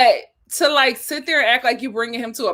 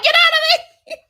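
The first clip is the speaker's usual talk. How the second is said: laughing mock voice; 4 dB louder than usual.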